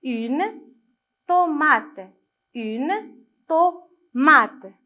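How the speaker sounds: "tomate" measured, with to and mate set apart; syllable-by-syllable reading. Shown as a demonstration. Teacher leading children in reading, "tomate" deliberately sounded out. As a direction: read "une tomate" out word by word, dividing "tomate". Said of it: "lisez avec moi".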